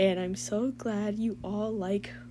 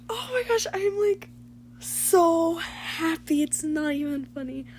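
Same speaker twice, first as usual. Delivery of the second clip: gushing; 7 semitones higher than usual. Real, not acted. Gushing over the milestone.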